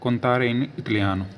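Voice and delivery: contare in italiano said slowly; slow, careful Italian for contare in italiano; slow Italian demo. Shown as a demonstration. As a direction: contare in italiano drawn out word by word. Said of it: "बोलते हैं contare".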